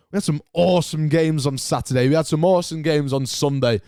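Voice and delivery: ordinary delivery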